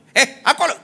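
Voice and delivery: high, loud "eh" of disbelief; indignant retort. Retold or imitated. Master answering a refusal, indignant.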